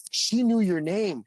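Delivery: loud, high, drawn-out imitation of amazed fans; mock-astonished shout; amazed exclamation. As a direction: crow it slowly at high pitch. Retelling amazed fans' reaction.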